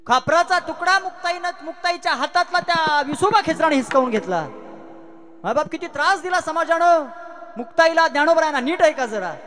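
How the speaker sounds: high, loud dramatic storytelling at full force; impassioned kirtan preaching. Acted. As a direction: cry out the injustice to the congregation. (आवेशपूर्ण, चढ्या आवाजातलं कीर्तन)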